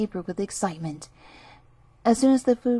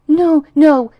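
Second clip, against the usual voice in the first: frightened refusal; 6 semitones higher, 12 dB louder than usual. A narrator's reading. Pig refuses the fox.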